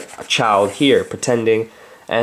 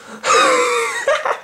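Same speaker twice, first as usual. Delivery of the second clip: laughing. Real, not acted. Laughs at own voice.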